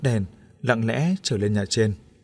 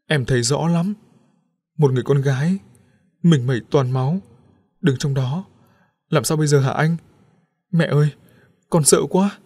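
higher, louder, frightened voice; frightened pleading; 4 semitones higher, 4 dB louder than usual. A narrator's reading. Wife telling husband and mother about the ghost, frightened. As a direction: tell it still shaking with fear. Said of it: "cô vẫn chưa hết sợ hãi".

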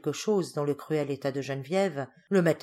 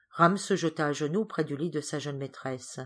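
ordinary delivery, nothing marked

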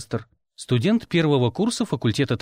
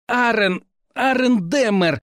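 groaned out; 9 semitones higher, slower than usual. A narrator's reading. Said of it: "простонал Тёмный".

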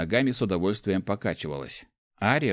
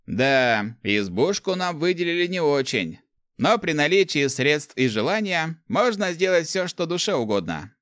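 lifted, emphatic pronouncement; authoritative; 8 semitones higher, 4 dB louder than usual. A narrator's reading. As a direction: declare it like an authority. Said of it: "авторитетно заявил Руди". (авторитетно)

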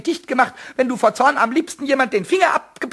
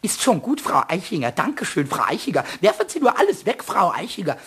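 lower, sing-song mockery of Eder; mocking mimicry; 7 semitones lower than usual. Pumuckl mocking Eder to himself, sneering mimicry. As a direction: ape the master's meek tone spitefully.

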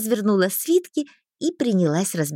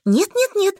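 high, loud repeated refusal; horrified; 11 semitones higher, 5 dB louder than usual. A narrator's reading. Heroine fears losing permission, in horror. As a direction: panicky no-no-no, shooting up. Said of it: "в ужасе замахала я руками".

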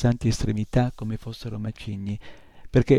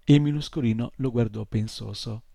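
ordinary delivery

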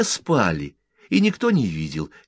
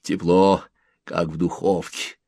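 plain reading voice

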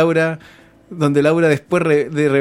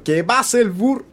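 mock host call; 5 semitones higher than usual. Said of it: "repeating Laura's call". (llamado de presentadora imitado)

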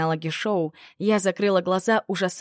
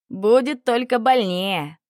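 slow, loud, higher menacing warning; 4 semitones higher, 5 dB louder, slower than usual. Acted. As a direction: drag out the threat slowly. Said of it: "предупредил сатир".

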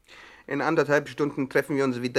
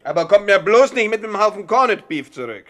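raised, high, forceful order; loud demand; 7 semitones higher, 9 dB louder than usual. Acted. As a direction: shout the warning at the men. Boss gives orders forcefully.

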